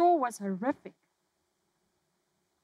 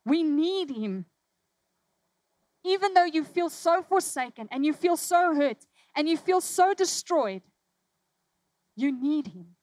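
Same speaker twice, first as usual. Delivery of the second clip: raised, high preaching; impassioned sermon climax; 5 semitones higher, 5 dB louder than usual. Acted. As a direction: preach it with passion. Preaching at full force.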